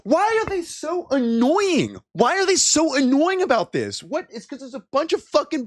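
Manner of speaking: exasperated whine at the news people. Real, not acted. Exasperated rant at news clip.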